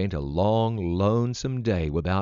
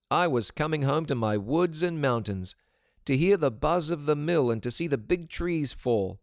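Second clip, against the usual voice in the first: put-on higher-pitched, earnest boy; runaway boy; 6 semitones higher than usual. A narrator's reading. Boy tells Vida's mother why he walked home, high and earnest. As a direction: play the homesick boy with raised pitch.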